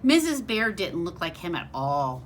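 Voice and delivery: ordinary delivery, nothing marked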